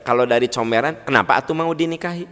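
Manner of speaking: ordinary delivery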